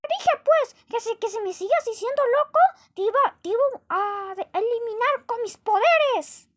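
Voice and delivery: high, loud unhinged villain shouting; shrill-free but wild high-pitched boasting threats; crazed apple. Acted. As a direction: rant like a mad apple who thinks he rules the world. Crazy apple repeats threat.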